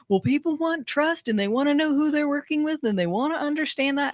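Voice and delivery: high condescending mansplainer voice; mocking a know-it-all lecturing. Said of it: "mansplain explanation".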